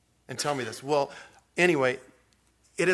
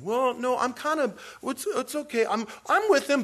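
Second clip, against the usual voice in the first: high-pitched, hedging voice; evasive waffler; 10 semitones higher than usual. Acted. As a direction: squirm out of the question in a high voice. Voicing an evasive half-committed believer.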